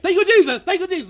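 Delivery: very high, very loud thank you Jesus; ecstatic shout. Shown as a demonstration. Pastor ending fake tongues with an ecstatic shout. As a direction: cry out in rapture. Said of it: "Like that".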